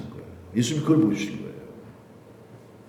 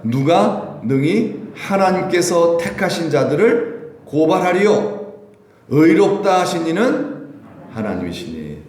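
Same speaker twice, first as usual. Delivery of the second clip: slow, measured recitation; scripture reading; 5 semitones higher, slower than usual. Acted. Pastor leads church in reading Romans, slow and measured. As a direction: read the verse slowly, weighing each word. Congregation reads scripture aloud.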